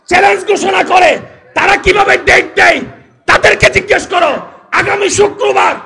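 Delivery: sustained high shout in short bursts; full-force preaching. Real, not acted. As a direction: drive it home to the crowd at full volume. (একটানা চিৎকার করে ওয়াজ)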